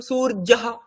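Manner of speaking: সূর্যহা as soor-ja-ha; j in place of y. Shown as a demonstration. Teacher gives the hypothetical Sanskrit reading, সূর্যহা with ja. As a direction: harden the ya of সূর্যহা into ja. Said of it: "সংস্কৃত উচ্চারণ করতাম".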